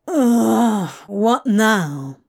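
exasperated groan of a complaint. A narrator's reading. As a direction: sigh it out, fed up. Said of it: "He groaned and threw his head back".

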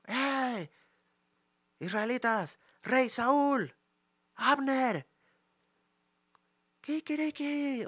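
high, drawn-out mock call from afar; imagined shout. David waking the sleeping Israelite camp, high mock call. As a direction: hail the sleeping camp across the hills. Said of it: "lo que gritó fue".

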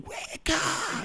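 high shouting preacher. Retold or imitated. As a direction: roar it like a preacher. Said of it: "when T D Jakes was shouting".

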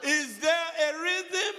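preacher calling to the congregation. Real, not acted. Preacher calls question to crowd.

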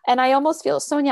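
normal talking voice

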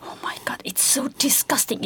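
hushed whisper of disgust; retold whisper. Whispering disgust to herself in the theatre. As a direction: whisper it so no one hears. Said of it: "And I was like".

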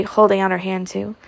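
usual speaking voice

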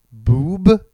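loud, bright, rising BOOB; gleeful boob. Shown as a demonstration. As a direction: say Boob as if it makes you smile. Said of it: "literally say boob without smiling".

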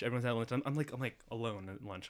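ordinary delivery

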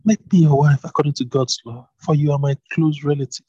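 Ruth pleading. A narrator's reading. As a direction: press your claim humbly but boldly. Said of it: "Ruth replied".

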